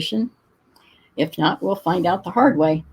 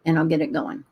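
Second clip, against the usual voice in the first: ordinary delivery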